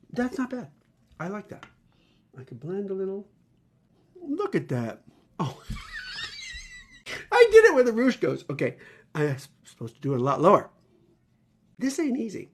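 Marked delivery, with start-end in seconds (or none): 5.7-6.8 squealing burst of laughter; high giggle shriek. Real, not acted. Laughing at own mistake.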